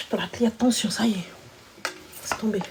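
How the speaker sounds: hushed whisper. Real, not acted. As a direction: drop to a whisper. Sudden whisper.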